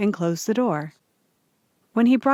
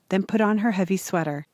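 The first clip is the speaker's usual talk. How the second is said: plain reading voice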